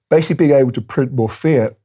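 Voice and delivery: talking through a yawn. Real, not acted. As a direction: stretch the words out mid-yawn. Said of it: "excuse my yawning".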